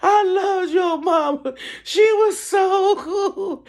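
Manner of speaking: high, drawn-out mock blubbering; imitated crying. Friend mourning her mama, crying. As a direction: imitate a man wailing in grief. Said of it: "and he's crying".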